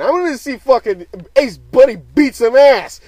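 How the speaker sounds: high, very loud excited yell; hyped shout. Real, not acted. Vlogger signing off before watching Ace Buddy, hyped shouting. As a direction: build into a roaring sign-off. Hyped sign-off about a fight.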